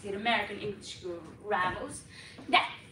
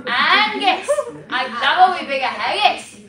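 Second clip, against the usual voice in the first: loud, booming Scottish call; Scottish dialect; 4 semitones higher, 11 dB louder than usual. Shown as a demonstration. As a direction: ham it up in hearty Scots. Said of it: "For Scottish".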